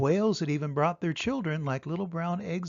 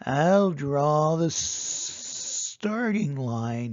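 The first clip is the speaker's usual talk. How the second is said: hissing rattlesnake drawing a line; snake hiss. A narrator's reading. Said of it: "hissed Rattlesnake".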